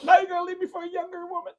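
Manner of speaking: very high-pitched fake sobbing complaint; hammed-up weepy squeal; mock tearful wail. Joking about being left for a younger woman, mock wailing.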